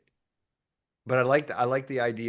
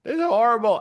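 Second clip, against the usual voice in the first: higher, louder complaining cry; mock wail; 11 semitones higher, 8 dB louder, faster than usual. Soul stuck on earth complaining, higher and louder. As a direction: whine it with despair. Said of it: "and you're going".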